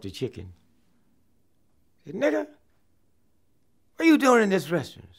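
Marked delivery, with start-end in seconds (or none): 2.1-5.1 high, loud, fast hostile demand; hammed-up Klansman confronting a diner; mock angry Klansman. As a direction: get in his face.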